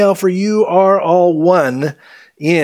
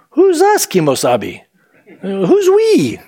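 high-pitched comic challenge; mock disbelief; 5 semitones higher than usual. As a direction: query it with a sudden squeak upward on the question. Mocks disciples counting themselves in.

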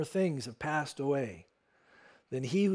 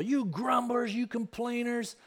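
mock angry rebuke; 6 semitones higher than usual. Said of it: "mad at these people".

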